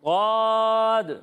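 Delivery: गौद as Arabic Daad, very long and heavy. Shown as a demonstration. Teacher models for pupils to repeat, a long heavy गौद. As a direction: hold a full, dark गौद at one pitch. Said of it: "इनकी अदायगी इन्होंने भी गलत की है".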